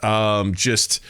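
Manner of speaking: ordinary delivery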